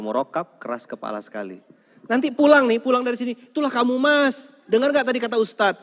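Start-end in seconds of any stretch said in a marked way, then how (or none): 2.1-5.8 high, loud scolding nag; nagging wife. Retold or imitated. Wife nagging her husband on the drive home, loud and high. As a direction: scold him all the way home.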